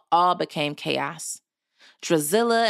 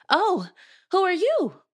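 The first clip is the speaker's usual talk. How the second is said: much higher startled question; surprised; 14 semitones higher than usual. A narrator's reading. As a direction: read it as an astonished girl, pitch leaping up. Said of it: "she asked in a surprised voice".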